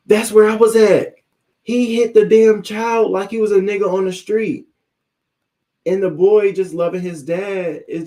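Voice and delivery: loud, high, indignant outburst; outraged. Real, not acted. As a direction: blast it out in disbelief. Outraged agreement about the abuse.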